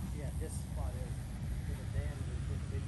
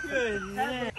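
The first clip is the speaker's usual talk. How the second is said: excited; 7 semitones higher, 8 dB louder than usual. Real, not acted. Praising a good throw.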